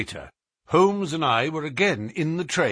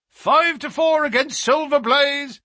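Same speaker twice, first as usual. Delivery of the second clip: high, loud betting-ring shout; roaring bookmakers; 14 semitones higher, 5 dB louder than usual. Acted. Betting ring calls odds on the favourite, roaring. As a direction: call the odds across a crowd. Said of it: "roared the ring".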